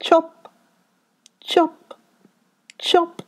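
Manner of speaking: high, spaced chop, chop, chop; chop sounds. A narrator's reading. Woodman chopping trees in the forest, each chop called high. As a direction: call each chop like an axe blow. Axe blows in story.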